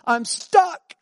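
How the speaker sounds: high, loud wail of distress; hammed-up cry for help; desperate youth. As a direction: imitate a panicked teen blurting it out. Youth's desperate call retold.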